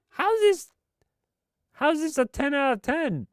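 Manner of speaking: very high-pitched disbelieving outcry; incredulous squeal. Real, not acted. Streamer outraged at a game's top score, high incredulous squeal.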